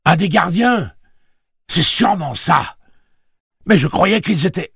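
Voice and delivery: loud, high-pitched excited realisation; exclamation criée. A narrator's reading. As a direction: shout over the noise as it dawns on you. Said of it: "s'écria-t-il". (cri de stupeur, voix forte)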